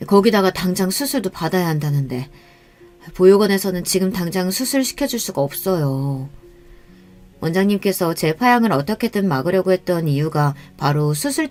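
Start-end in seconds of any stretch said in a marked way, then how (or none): none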